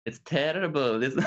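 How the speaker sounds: high, laughing go at Welsh; Welsh accent attempt. Shown as a demonstration. Learner trying the host's phrase, amused Welsh accent. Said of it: "I'll try".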